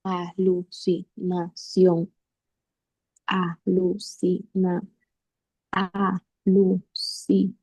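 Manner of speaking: "alucinación" lenta, sílaba por sílaba; silabeo. Shown as a demonstration. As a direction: separa a-lu-ci-na-ción con pausas breves. Alumna separa palabras en sílabas. ("alucinación" dicha en sílabas sueltas)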